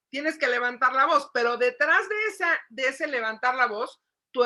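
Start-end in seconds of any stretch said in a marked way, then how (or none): none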